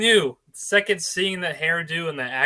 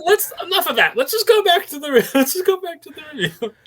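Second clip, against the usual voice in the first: laughing; 12 semitones higher, 6 dB louder than usual. Real, not acted. Laughing while steering back.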